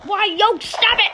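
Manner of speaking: high, loud panicked yell; startled yell. Real, not acted. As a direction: yell it out in alarm. Getting shot in game.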